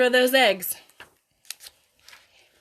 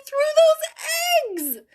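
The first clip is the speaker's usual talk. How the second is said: put-on boy's voice, high and slow; a worried young boy asking; Stuart; much slower than usual. A narrator's reading. Stuart asks how to win over the neighbour, high boyish voice. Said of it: "Stuart asked".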